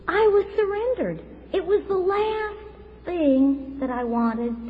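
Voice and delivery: high, loud outburst of conviction; impassioned testimony. Real, not acted. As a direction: proclaim it as if the revelation is hitting you now. Testimony climax about God speaking.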